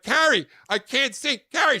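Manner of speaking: high, loud frantic shouts; panicked calling. Retold or imitated. Man blinded in Big Bird suit holding baby, panicked shouting. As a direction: shout for help, unable to see.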